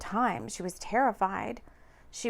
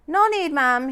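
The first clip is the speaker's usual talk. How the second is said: polite driver; 6 semitones higher, 7 dB louder than usual. A narrator's reading. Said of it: "politely tipping his hat".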